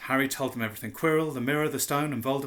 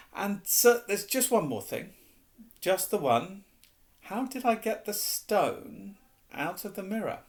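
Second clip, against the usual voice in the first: put-on young boy voice; 7 semitones higher than usual. Harry's voice, boy figure.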